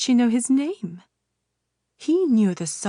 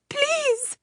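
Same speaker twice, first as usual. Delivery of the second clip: very high, strained plea; desperate pleading. Acted. Mother pleads with the witch for her daughter. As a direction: beg with everything you have.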